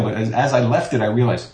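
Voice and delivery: ordinary talk, nothing marked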